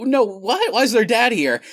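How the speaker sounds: high, loud alarmed gasp of shock. Retold or imitated. Retold shock at movie scene.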